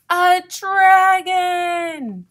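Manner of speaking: high, loud, drawn-out announcement; big storybook cry of alarm; dramatic shout. A narrator's reading. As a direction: shout it slowly, stretching the word. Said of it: "shouted Raccoon".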